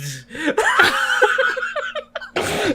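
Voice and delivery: squealing high laugh; helpless laugh; high laughing burst. Real, not acted.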